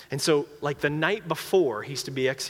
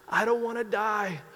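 much higher, pleading, building to a wail; condemned man screaming in terror, crying; terrified crying scream; 10 semitones higher than usual. Retold or imitated. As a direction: beg for your life in panic. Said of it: "he starts crying and screaming".

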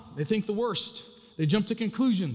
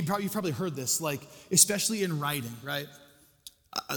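usual speaking voice

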